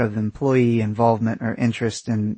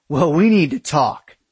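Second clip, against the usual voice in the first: raised-pitch, huffy insistence; insistent demand; 4 semitones higher, 4 dB louder than usual. A narrator's reading. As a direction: press for a talk mid-quarrel. Aaron insists in quarrel.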